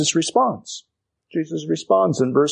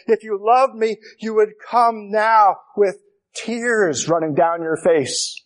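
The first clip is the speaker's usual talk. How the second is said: tearful plea; 6 semitones higher than usual. Pretend. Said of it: "you may be tempted to say".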